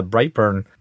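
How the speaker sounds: usual speaking voice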